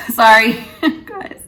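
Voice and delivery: loud, high burst through laughter; laughing apology. Real, not acted. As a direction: apologise while cracking up, voice jumping up. Overwhelmed, apologises to viewers laughing.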